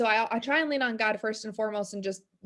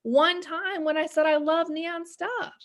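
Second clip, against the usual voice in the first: high, slow, gushing "oh my gosh"; stretched, thrilled quote, pitched up; gushing friend; 6 semitones higher, slower than usual. Retold or imitated. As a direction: act out a friend's delighted thanks. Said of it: "She was like".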